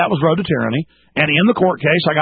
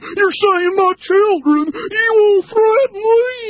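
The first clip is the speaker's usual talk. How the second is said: high, drawn-out mock wail; freaking-out cop; much slower than usual. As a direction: imitate a man losing it, stretched out high. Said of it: "He's sort of freaking out".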